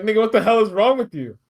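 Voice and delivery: hammed-up startled protest; mock shocked outrage. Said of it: "And then Bubby's like".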